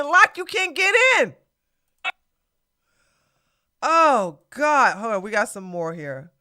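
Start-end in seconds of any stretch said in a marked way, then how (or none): none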